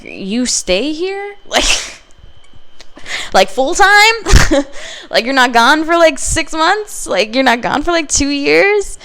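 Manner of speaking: high, incredulous questions rising at each end; mock-stunned kid, voice climbing high; shocked disbelief. To her stepfather, stunned he stays home, incredulous. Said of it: "I was in fucking shock".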